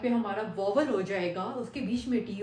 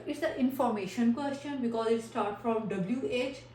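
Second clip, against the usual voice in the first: ordinary talk, nothing marked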